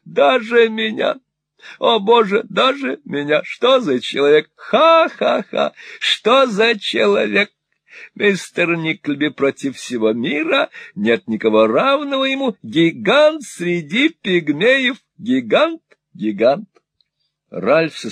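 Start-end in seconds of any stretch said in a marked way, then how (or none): 0.0-4.5 put-on high, wheedling old miser voice; fawning old moneylender. Old usurer fawning on Ralph, high and wheedling. Gride voiced in Nickleby reading. 4.5-5.7 put-on high, slow old man's cackle. Old Arthur cackling at Ralph, high and slow. Gride laughing. 6.0-16.7 put-on high, wheedling old miser voice; fawning old moneylender. Old usurer fawning on Ralph, high and wheedling. Gride voiced in Nickleby reading.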